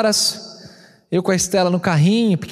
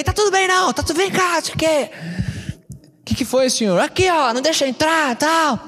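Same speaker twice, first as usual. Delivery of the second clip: high retold complaint; 10 semitones higher than usual. Retold complaint to the security guard.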